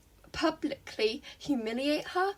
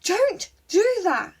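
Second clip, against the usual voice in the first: scolding yell; 7 semitones higher, 6 dB louder, slower than usual. A narrator's reading. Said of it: "Ochako yelled".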